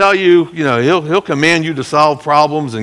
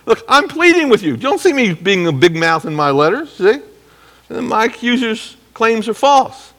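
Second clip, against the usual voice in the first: much higher pleading voice with a laugh; Paul pleading; 10 semitones higher, 3 dB louder than usual. Retold or imitated. Paul insisting to the Corinthians it is him, high and pleading. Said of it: "He's trying to say".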